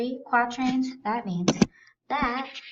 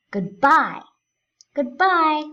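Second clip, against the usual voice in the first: goodBYE, second syllable punched louder; stress on BYE; 7 semitones higher, 7 dB louder, slower than usual. Shown as a demonstration. Teacher demonstrating iambic stress, BYE hit strongly. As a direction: lean hard on BYE in goodbye. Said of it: "we really do stress the bye".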